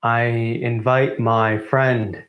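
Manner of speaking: usual speaking voice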